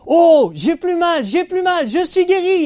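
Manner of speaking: high, loud, slow shout of joy; joyful cry. Retold or imitated. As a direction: cry out in amazement at being healed. Mother discovers she is healed.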